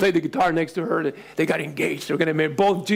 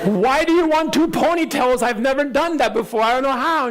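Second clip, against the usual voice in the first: exasperated dad; 8 semitones higher than usual. Retold or imitated. Dad protesting to his daughter, high and exasperated. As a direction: plead helplessly with a toddler.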